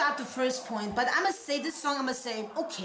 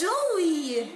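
drawn-out higher cry of delight; excited cheer; 5 semitones higher, 4 dB louder than usual. Real, not acted. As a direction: cheer the name out high. Cheering on a favourite act.

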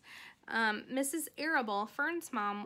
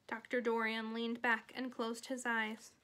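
ordinary delivery

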